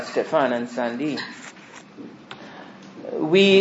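ordinary talk, nothing marked

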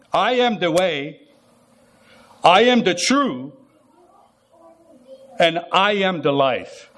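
slow, each I AM stressed; solemn declaration. Acted. Preacher voicing Jesus to the crowd, slow and solemn. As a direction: proclaim it slowly, word by word.

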